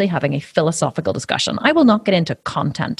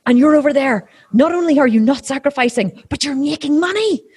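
higher, accusing, slowing at the end; indignant vegan; 8 semitones higher than usual. Retold or imitated. Vegans accusing her of profiting from veganism, indignant. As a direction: point the finger self-righteously. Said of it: "they go".

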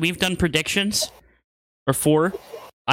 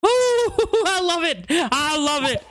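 laughing; 9 semitones higher, slower than usual. Real, not acted. Delighted at prediction.

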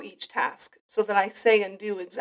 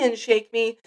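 ordinary delivery

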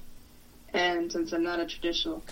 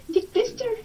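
much higher, louder disbelieving squeak; incredulous squeal; 5 dB louder than usual. Real, not acted. Repeats it even higher.

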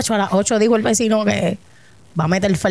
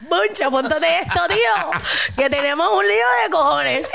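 higher-pitched hammed-up Spain Spanish; mock Spain accent; 5 semitones higher than usual. Playing a Spaniard addressing Moncho, mock Spain accent. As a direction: play an excitable Spaniard, laughter underneath.

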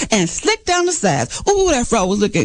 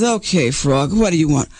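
low, unimpressed fox voice; storybook fox; 9 semitones lower than usual. A narrator's reading. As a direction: drop down low for Brother Fox. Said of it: "The fox looks out and says".

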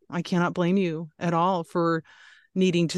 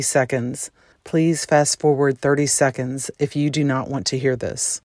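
announcement read; 3 semitones lower, 4 dB louder than usual. Acted. Trigger warning read.

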